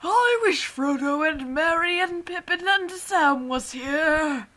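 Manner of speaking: hammed-up Gandalf. Gandalf wishes for the hobbits in a loud hammy wizard voice. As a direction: boom it out like a pompous wizard.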